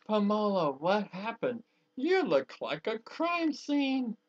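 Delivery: high, loud horrified gasp of a question; a mother appalled by her daughter's yellow dress; shocked outburst. Acted. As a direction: recoil in horror at the sight.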